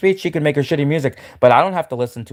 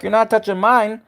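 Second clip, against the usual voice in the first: higher, mock-defensive put-on voice; guard yourself in a lightly voiced soldier; 7 semitones higher than usual. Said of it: "you'd be like".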